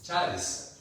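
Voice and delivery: "charis" stressed on the A, CHA-ris. Shown as a demonstration. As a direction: land on the first syllable of "charis". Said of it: "Si lleva acento en la A". ("charis" con acento en la A, CHÁ-ris)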